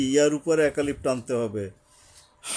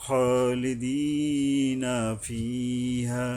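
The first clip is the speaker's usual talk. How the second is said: slow, melodic chanted tilawa; تلاوة مجودة بطيئة; Quranic recitation; much slower than usual. Acted. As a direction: draw out each word in measured cantillation. Quran verses chanted before lesson.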